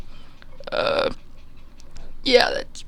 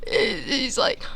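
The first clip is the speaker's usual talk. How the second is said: ordinary talk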